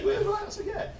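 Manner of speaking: laughing high Really; mock surprise. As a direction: act the customer's amazed doubt. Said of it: "He was like".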